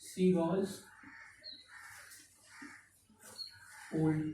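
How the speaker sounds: broken up by a long writing pause; slow dictation. Real, not acted. Teacher writing the next sentence for students, slow halting dictation. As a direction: dictate it in pieces, at writing speed. Writing out example while saying it.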